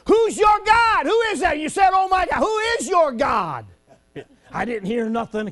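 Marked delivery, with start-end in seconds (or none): 0.1-3.7 high, loud holler across the parking lot; mock preacher holler at a girl in a parking lot. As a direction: call it out loud to the stranger. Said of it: "So I'm hollering".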